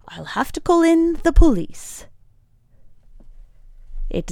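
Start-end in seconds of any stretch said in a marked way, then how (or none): 0.1-2.1 high, loud, superior threat; condescending. Acted. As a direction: talk down to them with a threat. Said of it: "condescension rolling down".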